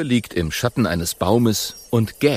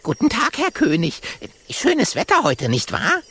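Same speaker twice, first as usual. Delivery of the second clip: high, eager little monkey voice; young monkey, overly polite; polite monkey boy; 13 semitones higher than usual. Acted. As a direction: greet the king politely, high. Monkey Jojo greets lion.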